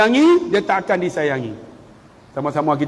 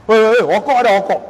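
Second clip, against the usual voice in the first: loud, offhand call of a careless man; hammed-up bloke calling out for a smoke; mock rough call; 4 semitones higher, 4 dB louder than usual. Preacher mocks a man chatting during wudu, loud.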